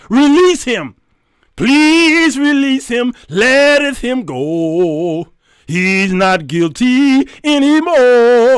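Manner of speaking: loud, very drawn-out church wail; mock mighty plea. Church woman commanding the judge, hammed-up mighty plea. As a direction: belt it out slowly, stretching every vowel. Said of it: "with all the might that I have".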